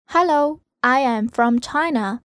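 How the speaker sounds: usual speaking voice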